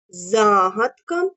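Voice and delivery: very slow, each syllable stretched; dictation drawl. Shown as a demonstration. Teacher dictating a word to the class. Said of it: "протягуючи кожний склад".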